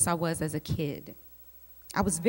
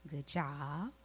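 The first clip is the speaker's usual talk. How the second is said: quiet approval; 4 semitones lower, 13 dB quieter than usual. Retold or imitated.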